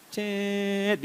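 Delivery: high, drawn-out pinch noise; mimed pinch sound effect. Acted. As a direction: imitate a sharp pinch with a stretched squeak. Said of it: "tangannya nyubit".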